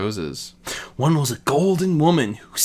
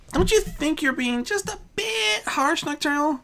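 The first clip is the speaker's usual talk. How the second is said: higher, softer gentle plea; Merida's voice; 4 dB quieter than usual. Acted. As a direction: gently question the harshness.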